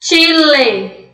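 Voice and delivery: CHILE said "tchi-le"; crisp "tch" and closed final E on Chile; Spanish CH. Shown as a demonstration. Teacher modelling the country's name, Spanish CH. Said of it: "ler com o som de che".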